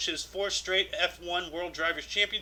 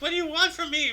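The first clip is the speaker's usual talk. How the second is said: high, whiny exasperated voice; pleading what do you want; exasperated plea; 10 semitones higher, 3 dB louder than usual. Pretend. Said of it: "Like, like".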